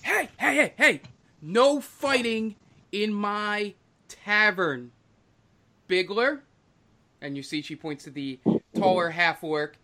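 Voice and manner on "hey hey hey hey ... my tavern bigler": high-pitched tavern keeper stepping in; quick high heys, then a slow, firm warning; scolding bartender. Acted. Bartender stopping a fight in her tavern, high scolding voice.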